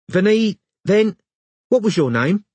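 high, halting London woman; high-pitched Londoner fumbling, then asking; London accent. A narrator's reading. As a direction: stumble on a name in a London accent. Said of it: "She had a London accent".

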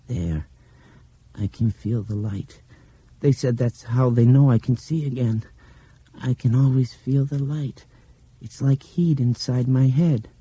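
put-on high, faraway child voice; dreamy child narrating what she feels; young blind girl, dreamy. A narrator's reading.

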